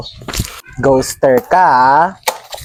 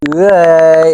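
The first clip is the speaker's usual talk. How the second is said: usual speaking voice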